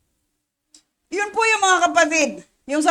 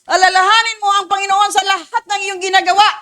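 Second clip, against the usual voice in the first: scripture reading; 3 semitones higher, 4 dB louder than usual. Real, not acted. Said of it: "reading verse aloud".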